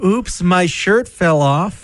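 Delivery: slow, high, coy mock singer. Co-host mocks the shirtless singer, slow and coy. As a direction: draw out the fake apology. Mocking [name] on stage.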